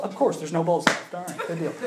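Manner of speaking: normal talking voice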